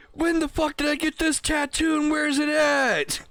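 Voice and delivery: hammed-up panicked holler; mock confused yell. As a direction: shriek it like waking up with a mystery tattoo. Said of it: "I hear this".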